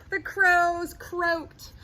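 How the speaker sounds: put-on slow, sing-song reading to children; storytime reading. A narrator's reading. Reading a picture book to kids, slow storytime voice. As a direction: read it like a bedtime picture book.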